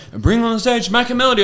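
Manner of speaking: high-pitched mock announcer call; stage announcer. MC calling comedian to the stage, mock announcer. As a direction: imitate an MC bringing on the next act.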